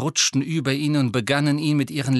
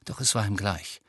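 plain reading voice

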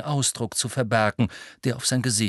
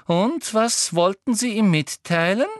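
higher, sugary-eager Umbridge; 10 semitones higher, 3 dB louder than usual. A narrator's reading. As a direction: voice the prying Umbridge. Voices Umbridge. (Umbridge mit hoher, neugieriger Stimme)

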